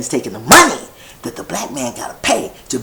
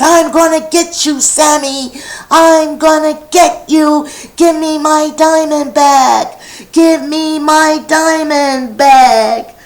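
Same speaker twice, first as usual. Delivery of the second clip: high, loud, insistent shouting; a boy yelling for his diamond while running, higher and louder; chasing yell; 9 semitones higher, 5 dB louder than usual. Acted. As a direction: holler after the thief. Frankie chases and yells.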